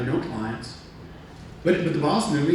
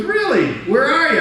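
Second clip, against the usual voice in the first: much higher, louder startled question; surprised boss; 8 dB louder than usual. Retold or imitated. The boss on the phone, startled that Tom is in New York. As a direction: squeak out the surprise.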